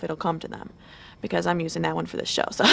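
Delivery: ordinary delivery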